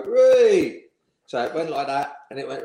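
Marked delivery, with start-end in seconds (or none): 0.0-0.9 high, loud jeering whoop; mock cheer. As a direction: whoop like a dressing room of lads mocking the boss. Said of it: "all go".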